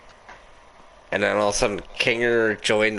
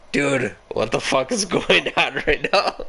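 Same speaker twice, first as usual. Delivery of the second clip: higher, cracking up mid-question; laughing disbelief; 5 semitones higher than usual. Retold or imitated. Retelling reaction to a drunk friend, laughing disbelief. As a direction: ask it incredulously through laughter.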